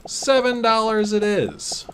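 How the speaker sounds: high, slow proclamation; grand announcement. Real, not acted. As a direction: proclaim the new price. Announcing price hike.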